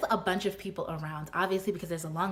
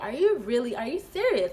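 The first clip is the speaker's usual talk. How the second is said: higher, louder disbelief; incredulous; 5 semitones higher, 4 dB louder than usual. Retold or imitated. Woman embarrassed before the queue, incredulous. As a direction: question him in rising exasperation.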